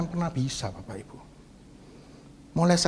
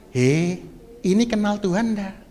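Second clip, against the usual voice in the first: ordinary talk, nothing marked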